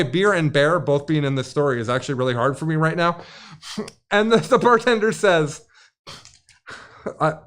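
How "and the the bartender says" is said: ordinary delivery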